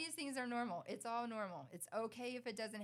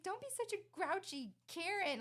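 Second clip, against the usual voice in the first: teasing; 11 semitones higher, 4 dB louder than usual. Retold or imitated. Mom teasing grouchy son.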